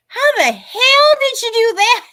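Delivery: high, loud, slow, amazed voice for the husband; husband's astonished quote. Retold or imitated. Retelling how her husband reacted to her cheap outfit, high and amazed. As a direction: play him floored by the bargain. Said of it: "He's like".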